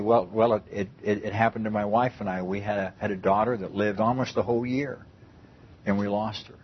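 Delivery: quiet, steady words to soothe her; calm reply. Retold or imitated. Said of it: "looked at her very calmly and said".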